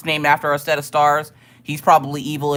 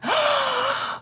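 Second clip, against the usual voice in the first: big, high audible gasp; dramatic gasp; 4 dB quieter than usual. Acted. Streamer marvelling at the pentagram of success, dramatic gasp. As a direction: suck in air in mock awe.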